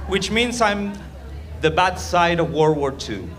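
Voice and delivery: usual speaking voice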